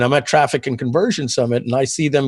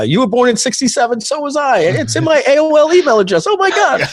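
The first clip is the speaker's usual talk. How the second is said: squeaky high, louder disbelief at each shared detail; rising higher and higher with delighted shock; excited discovery; 13 semitones higher, 3 dB louder than usual. Retold or imitated. Reliving meeting his look-alike friend, high excited amazement.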